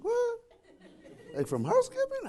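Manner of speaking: high and shrill in disbelief, laughing; startled laughing question. Retold or imitated. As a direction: squeak the question as if you can't believe it. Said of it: "He's like".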